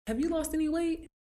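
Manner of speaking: high surprised question; high-pitched gushing friend. Retold or imitated. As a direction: squeal it like an impressed friend.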